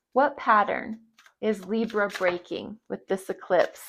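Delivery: ordinary talk, nothing marked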